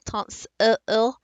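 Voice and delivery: "tons" broken off before a lazy "uh"; isolated schwa. Shown as a demonstration. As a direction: separate the weak "uh" from "tons". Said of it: "You almost hear the I".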